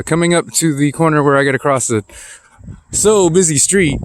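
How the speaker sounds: mock dramatic. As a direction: playfully hype up the danger. Mock alarm, then "not really.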